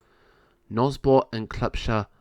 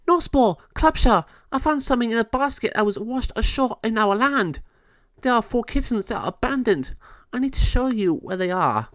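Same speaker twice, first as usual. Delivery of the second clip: urgent fairy; 12 semitones higher than usual. Acted. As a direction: rush in with urgent news. Fairy Natasha reports to king.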